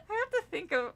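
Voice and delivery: breaking up with laughter; laughing. Real, not acted. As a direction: laugh through the words. Laughing while speaking.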